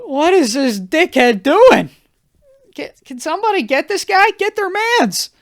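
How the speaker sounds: high, loud mock-desperate plea; retold exasperated outcry. Guest pestered by a drunk guy, high exasperated cry for help. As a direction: call out for rescue in comic exasperation.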